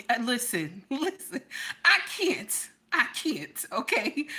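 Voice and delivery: ordinary delivery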